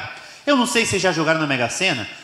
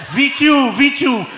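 higher-pitched excited number check; mounting excitement; 6 semitones higher, 3 dB louder, slower than usual. Acted. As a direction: read it out as a match found. Ricardo excitedly checking winning numbers.

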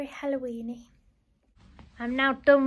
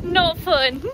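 laughing; 6 semitones higher than usual. Real, not acted. Laughing walk in rain.

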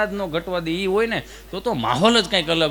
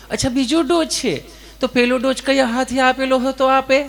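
higher, polite nurse asking; sweet official nurse tone, pitched up; 4 semitones higher than usual. Acted. Nurse asking Gabho about his dose, higher and polite.

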